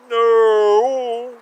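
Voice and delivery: a dog flatly refusing; grumpy dog no. Pretend. Voicing Gus for the child, loud grumpy no.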